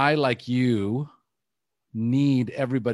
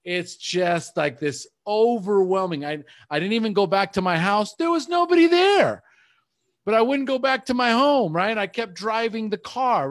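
emotionally overwhelmed; 9 semitones higher, 3 dB louder than usual. Real, not acted. Man recalls crying alone in his car, overwhelmed and high-pitched. Recounting sobbing breakdown while driving.

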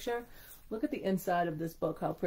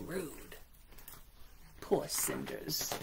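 whispering; 9 dB quieter, much slower than usual. Real, not acted. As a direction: breathe it with pity. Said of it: "aside in sympathy".

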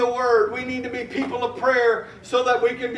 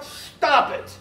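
self-scolding; 3 semitones higher, slower than usual. Retold or imitated. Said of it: "I'm telling myself".

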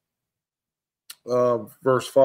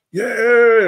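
high, loud drawn-out cheer; mock-jubilant shout, pitched way up; acted cheer; 11 semitones higher, 9 dB louder than usual. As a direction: cheer like a whole camp celebrating. Acting out camp cheering the ark.

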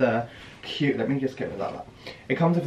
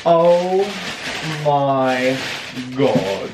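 stunned oh my God; much slower than usual. Real, not acted. Overwhelmed by the new shoes.

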